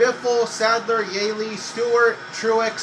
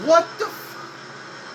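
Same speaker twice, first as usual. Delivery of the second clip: high breathy disbelief, slow; stunned whisper; 7 semitones higher, 4 dB quieter, much slower than usual. Real, not acted. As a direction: gasp it out in shock. Disbelief at AI pitting.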